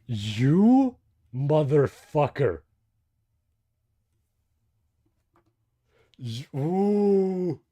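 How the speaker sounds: loud, drawn-out cry of shock; stunned outburst. Real, not acted. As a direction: let it burst out loud and stretched. Reacts to shocking story reveal.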